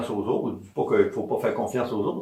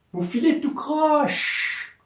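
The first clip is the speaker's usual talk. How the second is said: vous filez tout croche drawn out slowly, much higher; slow key phrase; 13 semitones higher, much slower than usual. Shown as a demonstration. Healer stressing the key phrase to patients, slow and high. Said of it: "Voilà la petite phrase".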